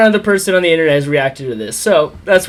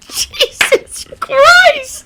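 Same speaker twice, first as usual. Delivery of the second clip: laughing hard; 4 dB louder, much slower than usual. Real, not acted. Howling at the clip.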